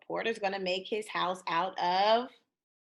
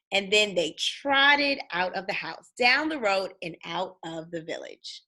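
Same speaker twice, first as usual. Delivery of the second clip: put-on lively read-aloud for kids, bouncy tune; storybook reading; 4 semitones higher, 6 dB louder than usual. A narrator's reading. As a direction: read it like bedtime story time.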